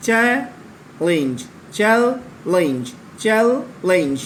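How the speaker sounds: slow চ্যালেঞ্জ split into its syllables; syllabic division. Shown as a demonstration. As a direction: stretch চ্যালেঞ্জ out, chal-lenge. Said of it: "syllabic ডিভিশনটা আছে". (চ্যালেঞ্জ ধীরে, ভেঙে ভেঙে)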